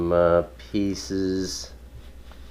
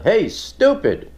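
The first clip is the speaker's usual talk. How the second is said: high, slow mock yell, louder; yelled at a crowd; 14 semitones higher, 5 dB louder, slower than usual. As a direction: holler it out like heckling passing strangers. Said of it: "I just yelled out into the crowd".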